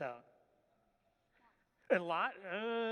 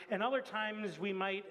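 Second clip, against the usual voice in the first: ordinary talk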